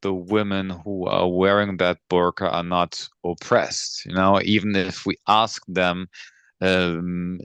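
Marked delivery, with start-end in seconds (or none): none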